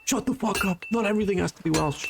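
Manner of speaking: imitated scolding chat. Streamer voicing viewers fed up with Welsh guesses, mock scolding. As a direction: imitate exasperated viewers without shouting. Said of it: "everyone was like".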